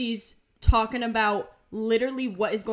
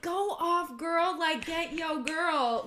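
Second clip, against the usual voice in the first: higher-pitched sassy cheering; hyping girls on; 5 semitones higher, slower than usual. Acted. Podcaster acting out her livestream comments, higher hype. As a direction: egg her on like a fan. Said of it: "commenting, being like".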